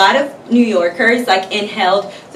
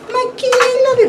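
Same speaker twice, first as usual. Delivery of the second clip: much higher squeaky pet voice; faster than usual. Real, not acted. Owner cooing at her cat Coral, squeaky baby talk.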